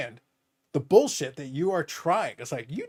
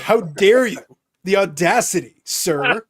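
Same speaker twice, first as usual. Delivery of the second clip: louder, theatrical outrage; mock indignation; 2 semitones higher, 7 dB louder, slower than usual. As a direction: play the offended aristocrat. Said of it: "It's like".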